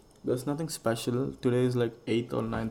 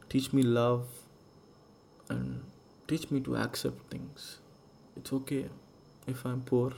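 prayer. Acted. Prayer-like address to universe.

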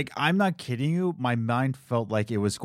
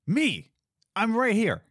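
exasperated; 10 semitones higher than usual. Retold or imitated. Exasperated host.

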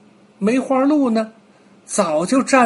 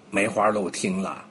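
ordinary delivery

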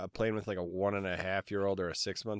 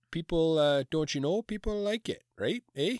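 playful hint of a Canadian accent, higher; 7 semitones higher than usual. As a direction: lean on the Canadian eh, lightly. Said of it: "very Canadian".

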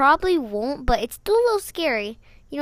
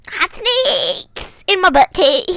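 segment announcement; 5 semitones higher, 8 dB louder, much slower than usual. Acted. Announcing silly segment name.

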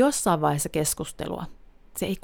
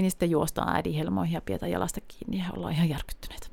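fast hushed whisper; whispered rush; 7 dB quieter, faster than usual. Real, not acted. Vlogger whispers about scared kids running, quickly. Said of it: "whispered fast telling".

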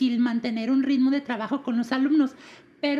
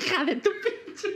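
laughing; 4 semitones higher, 4 dB quieter, slower than usual. Real, not acted. Laughing at teacher's confession.

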